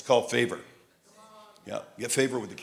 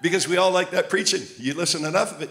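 ordinary delivery

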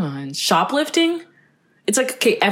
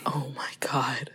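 hushed, slow "Oh my God"; whispered quote; 5 semitones lower, 11 dB quieter, slower than usual. Retold or imitated. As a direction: whisper it in a panic. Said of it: "she was like".

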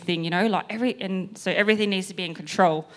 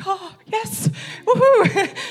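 high whoop breaking into laughter; acted elation; 14 semitones higher, 5 dB louder, much slower than usual. Trainer hearing client wants church, gleefully excited. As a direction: celebrate with a rising, laughing cheer.

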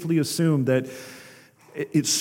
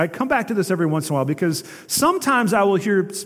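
ordinary delivery

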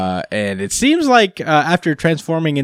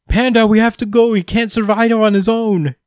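Gory pleading; 11 semitones higher than usual. Retold or imitated. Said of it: "He's like".

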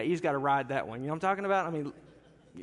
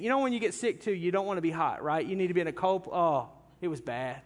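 normal talking voice

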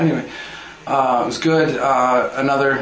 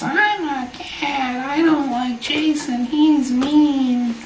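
very high, slow squeaky cat; 4 dB louder, much slower than usual. Pretend. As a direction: squeak it out slowly like a cartoon cat. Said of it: "that was my cat voice".

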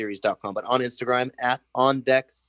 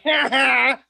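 high, loud taunting laugh-words; mocking ha ha; 12 semitones higher, 5 dB louder than usual. Teasing a bummed kid fan, taunting ha ha. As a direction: taunt the sad little fan. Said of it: "I might even say".

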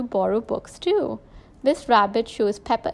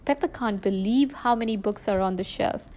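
ordinary delivery

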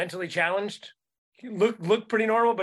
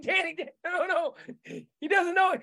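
high, hurried plea to stop; urgent pleading; 12 semitones higher than usual. Retold or imitated. The narrator begs Danny to stop, high urgent plea. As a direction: pull your friend back, voice up high. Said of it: "I was like".

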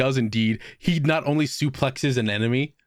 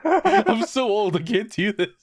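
put-on complaining old hero; 8 semitones higher, slower than usual. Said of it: "and then goes".

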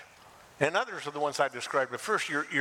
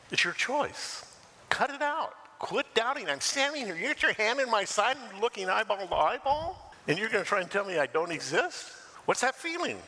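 exasperated rebuke; 9 semitones higher than usual. Acted. Jesus rebuking Thomas, acted.

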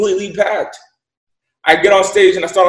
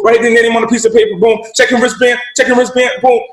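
higher, louder, harried rush of words; frantic friend; 4 semitones higher, 4 dB louder than usual. Retold or imitated. Roommate Patrick overwhelmed checking in the crowd, frantic and loud. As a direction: imitate a swamped buddy begging for help.

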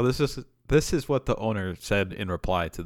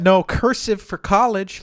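slow, higher, louder mock teacher warning; 9 semitones higher, 4 dB louder, slower than usual. The teacher warns second graders about college in a mock voice. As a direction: lightly play a teacher threatening second graders.

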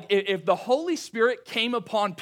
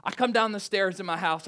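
ordinary talk

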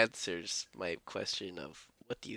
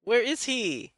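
high, loud where is he; excited; 13 semitones higher, 5 dB louder, slower than usual. Real, not acted. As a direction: call out eagerly for him. Excited call for missing character.

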